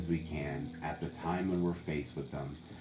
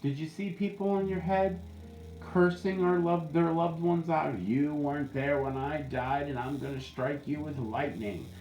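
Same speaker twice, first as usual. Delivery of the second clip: mock outraged spirit; 8 semitones higher than usual. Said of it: "I'm like".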